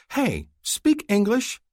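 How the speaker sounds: high, loud, slowed indignant hey; indignant interruption. A narrator's reading. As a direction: cut in, annoyed and frowning. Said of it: "Mahdi interrupts, frowning".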